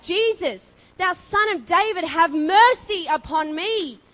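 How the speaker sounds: loud, high, drawn-out desperate shout; yelled cry for mercy. Acted. Blind beggar calling to Jesus through a crowd, yelling. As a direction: cry out over a massive crowd. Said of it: "He yelled and cried out".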